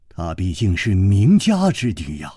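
plain reading voice

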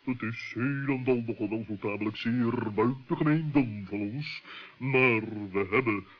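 a deep, ponderous bird owning up to a blunder. Acted. Deep-voiced bird admits mistake.

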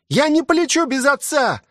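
put-on high child's voice, refusing; struggling boy's high cry. A narrator's reading. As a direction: protest in a high-pitched yell. Struggling boy refuses to leave.

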